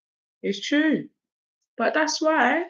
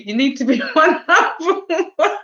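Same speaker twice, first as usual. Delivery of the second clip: higher, breaking into laughter; laughing; 6 semitones higher than usual. Real, not acted. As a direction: laugh through the words. Jokes with Jenny, laughing.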